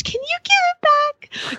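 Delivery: hammed-up polite begging; mock sweet plea. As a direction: pretend innocent request. Mock email to stranger.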